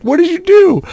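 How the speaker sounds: high, loud alarmed question; shocked, rising high. Retold or imitated. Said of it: "She's like".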